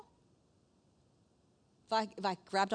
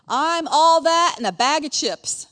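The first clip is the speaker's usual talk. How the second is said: loud, higher, drawn-out boast; boastful king; 6 semitones higher, 12 dB louder, slower than usual. Retold or imitated. Proud king marveling at his own greatness, boastful. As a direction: crow it slowly at full volume. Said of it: "marveled at his own self".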